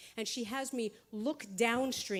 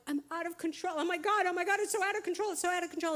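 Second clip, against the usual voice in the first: high, fast panicky babble; frantic panic; 8 semitones higher, much faster than usual. Retold or imitated. Standing in the healer's stream, frantic and high. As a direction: spiral into panic over losing control.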